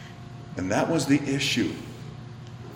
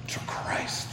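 whisper; 9 dB quieter than usual. Real, not acted. Preacher drops to hushed aside.

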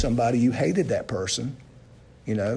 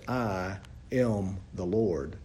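slow, lower, weighty I am the Lord; solemn emphasis; 6 semitones lower, 7 dB quieter, slower than usual. Shown as a demonstration. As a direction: lay weight on each word of I am the Lord. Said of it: "emphasized with the statement".

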